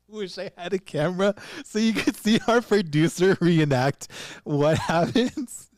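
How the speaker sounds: high, loud, through real laughter; helpless amusement pushing the voice up; laughing. Real, not acted. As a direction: crack up while talking. Cracking up at producer's reenactment.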